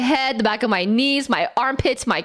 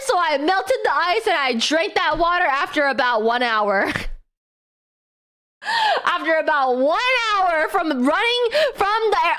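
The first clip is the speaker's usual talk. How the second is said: ordinary talk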